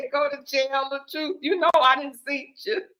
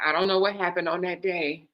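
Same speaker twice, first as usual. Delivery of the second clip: ordinary delivery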